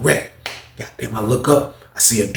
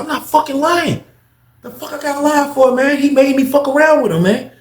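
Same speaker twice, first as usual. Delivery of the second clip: much higher, defensive insisting; indignant Paul; 13 semitones higher than usual. Retold or imitated. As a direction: protest the accusation. Paul insists he's not lying.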